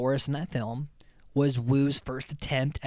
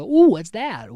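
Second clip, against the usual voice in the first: loud, high, playful interruption; Sammy; 7 semitones higher, 13 dB louder, slower than usual. Retold or imitated. Podcaster imitating an interrupting lab visitor, loud and high. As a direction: cut in louder with a swooping ooh. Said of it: "Sammy's like".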